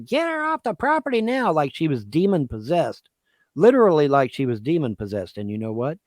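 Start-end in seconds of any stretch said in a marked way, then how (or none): 0.0-1.5 mock scream, much higher; retold scream. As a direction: imitate mom screaming at the deputy. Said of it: "started screaming at the top".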